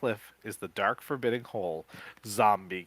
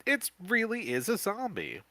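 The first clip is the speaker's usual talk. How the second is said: cry out; 11 semitones higher than usual. Acted. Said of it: "you cry".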